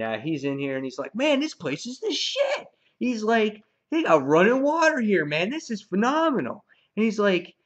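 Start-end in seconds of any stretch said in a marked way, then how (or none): 1.1-2.7 high-pitched excited admiration; wowed, pitch soaring; impressed. Retold or imitated. Acting impressed Negan admiring the house. 3.9-6.6 high, louder mock amazement; lightly acted excitement, pitch lifted; impressed. Acting Negan marvelling at the house.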